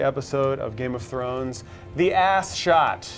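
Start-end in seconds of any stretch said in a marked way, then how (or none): 1.9-3.1 slow, higher mock-grand reveal; grand announcement. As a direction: announce it drawn out like a big title. Announcing a favorite recurring moment.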